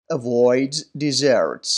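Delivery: very slow "avoids", ending in a clear z; pronunciation demo. Shown as a demonstration. Teacher checking answer five, very slow "avoids". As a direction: say "avoids desserts" very slowly and carefully. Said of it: "طریقهٔ تلفظ درستش رو".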